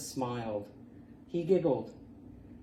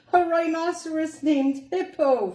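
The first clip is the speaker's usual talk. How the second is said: high, loud, laughing cry; crying with laughter; 9 dB louder than usual. Acted. Porcupine turning the joke back on the rhinoceros, laughing out loud. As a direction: shriek it out, helpless with laughter. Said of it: "Fluffy cried".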